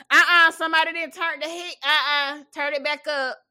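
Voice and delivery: loud, high mock scolding elder; scolding old relative. Grandma scolding about the thermostat, loud mock scolding. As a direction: bark it like a grumpy granny guarding the heat.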